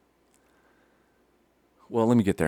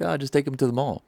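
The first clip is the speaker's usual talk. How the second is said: raised, dismissive dad voice; mock exasperation; 6 semitones higher, 4 dB louder than usual. Dad brushing off mother's worry, mildly exasperated. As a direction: brush it off, higher-pitched.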